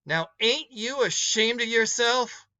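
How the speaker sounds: a hint of a reproachful older woman; scolding woman.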